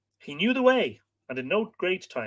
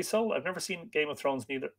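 usual speaking voice

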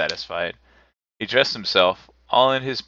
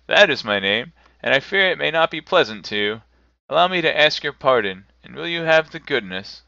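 higher, louder, insistent character voice; earnest, apologetic Scrooge; 6 semitones higher, 5 dB louder than usual. A narrator's reading. Scrooge asks the gentleman's pardon, earnest and eager.

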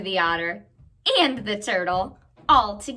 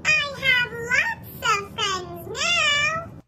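much higher, far-off echo reply; 14 semitones higher than usual. Acted. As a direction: sound like a distant echo. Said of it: "helping us with our echo part".

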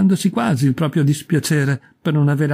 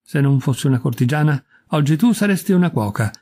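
plain reading voice

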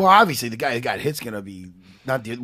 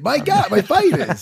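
flustered laugh through the words, higher; 11 semitones higher, 4 dB louder, slower than usual. Real, not acted. Cohost laughing off being teased about his fighters. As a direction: laugh it off, embarrassed.

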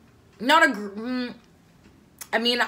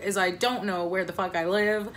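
ordinary talk, nothing marked

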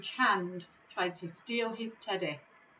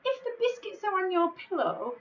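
put-on reasoning boy; 11 semitones higher, 3 dB louder than usual. Tom reasons.

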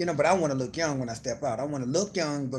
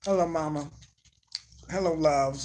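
normal talking voice

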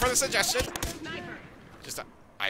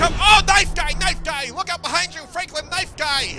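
loud urgent warning, higher; alarm shout; 6 semitones higher, 5 dB louder than usual. Real, not acted. Warning teammate of knife guy.

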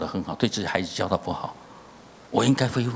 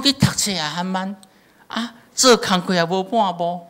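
higher, slow, mocking scold; mocking father; 8 semitones higher, 3 dB louder than usual. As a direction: drag out the scorn in a higher, put-on voice. Father mocks son's failures.

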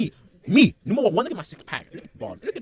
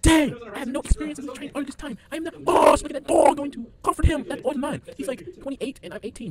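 fast, high and louder taunting; fast gloating; 12 semitones higher, 10 dB louder, faster than usual. Real, not acted. Mocking his father on the floor, fast and loud. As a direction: rattle off the gloating at speed.

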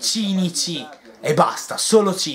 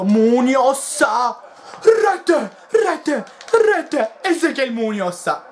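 high, shouted goal call; breathless italian goal commentary; 9 semitones higher, 3 dB louder, much slower than usual. Real, not acted. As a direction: yell the goal over and over. Late equaliser goal call. (telecronaca da gol urlata)

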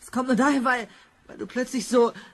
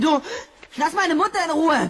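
louder, higher furious demand; angry shout; 5 semitones higher, 7 dB louder than usual. Acted. Son shouting at Biff to leave his mother alone, angry. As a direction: yell at him to back off.

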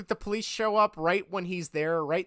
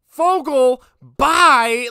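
loud, higher curt brush-off; teacher's dismissal; 6 semitones higher, 14 dB louder, much slower than usual. Retold or imitated. Teacher dismissing a repeat interrupter, loud and curt. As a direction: bark it like a fed-up teacher. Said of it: "that teacher's like".

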